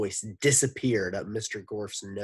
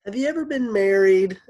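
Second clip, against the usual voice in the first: put-on much higher, louder girl voice; cat on a piano voice; 12 semitones higher, 13 dB louder, faster than usual. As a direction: squeak it out like keys under paws. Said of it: "Allison's voice was like a cat walking across a piano".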